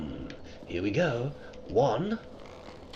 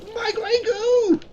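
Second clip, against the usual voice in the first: My grey goo! cried out much higher, slow and drawn; mock despair; 5 dB louder, slower than usual. Real, not acted. Streamer wailing My grey goo! in high hammed-up despair. Second, higher take of cry.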